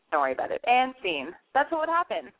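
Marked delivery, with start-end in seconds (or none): 0.1-0.6 low, offhand mock brush-off; pretend caller. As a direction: drop the voice and wave him away. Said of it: "you'd be like".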